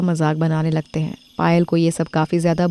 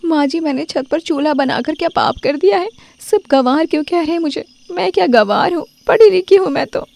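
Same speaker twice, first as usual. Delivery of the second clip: upset and hurt; 9 semitones higher than usual. Acted. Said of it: "वो घर आकर रोने लगती".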